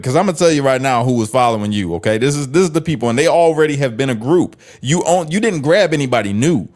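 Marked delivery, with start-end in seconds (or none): none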